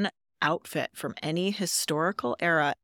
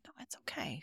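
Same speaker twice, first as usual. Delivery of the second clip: whisper; 19 dB quieter, faster than usual. Retold or imitated. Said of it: "I'll just be like".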